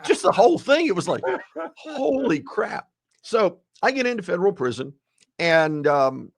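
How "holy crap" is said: stretched out awe. Retold or imitated. Retold shock.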